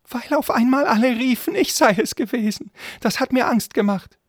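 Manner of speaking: very high, loud, squeaky voice; pleading frightened servant. Acted. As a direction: beg your master to believe you. (flehender Diener mit hoher Stimme)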